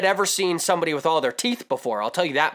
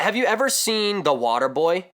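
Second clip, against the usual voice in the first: ordinary talk, nothing marked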